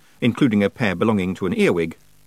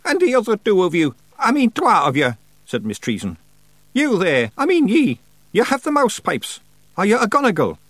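high, commanding witch voice; stern old witch; 12 semitones higher, 4 dB louder than usual. Acted. Witch orders Feegles to attention, stern. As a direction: order them about in high Scots-tinged tones.

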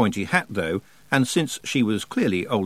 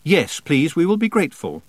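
higher, firm interjection; louder and quicker; 7 semitones higher, 4 dB louder than usual. A narrator's reading. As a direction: cut in politely but firmly over someone. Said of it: "louder and quicker".

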